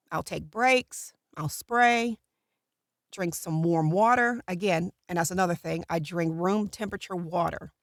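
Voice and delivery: normal talking voice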